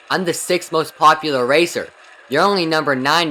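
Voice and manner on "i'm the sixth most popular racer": ordinary talk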